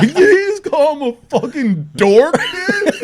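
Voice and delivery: high, slow, laughing disbelief; squeaky shocked kid voice, half laughing; mock gasp of amazed kids; stunned kids. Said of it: "Everyone's like".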